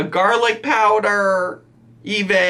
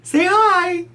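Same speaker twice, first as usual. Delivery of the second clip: high, singsong pet-talk; coaxing a pet; slower than usual. Real, not acted. Owner getting the pet to say hi, high coaxing. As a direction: coax in a very high voice.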